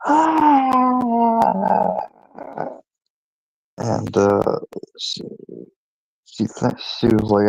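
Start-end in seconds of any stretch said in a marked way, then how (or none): 0.0-2.0 sudden very high wailing cry; high mock wail of a crying toddler; imitated child's wail. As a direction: let out a shrill baby's cry, retold. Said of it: "I just went".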